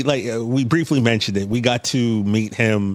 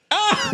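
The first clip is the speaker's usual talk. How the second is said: high laughing "Ah". Retold or imitated. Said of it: "you kinda like".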